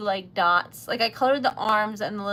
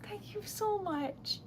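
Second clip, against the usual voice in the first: high, soft, slow thanks; real heartfelt high, hushed thanks; overwhelmed gratitude; 10 semitones higher, 8 dB quieter, slower than usual. Real, not acted. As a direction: draw it out softly, high and touched. Overwhelmed by gifts from viewers.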